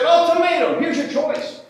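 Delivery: high, loud, commanding mock ultimatum; mock German commander. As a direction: bark the threat like a hammed-up officer. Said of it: "and said".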